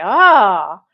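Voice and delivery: loud, swelling mock triumph; hammed-up chest-out boast; puffed-up pride. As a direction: imitate a man glowing with his own success. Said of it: "puffed his chest and felt like a man".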